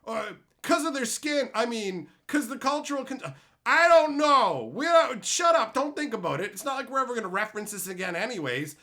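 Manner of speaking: high, loud flustered babble; hammed-up defensive company; mocking flustered company. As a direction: panic then snap shut up. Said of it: "No, but they come from".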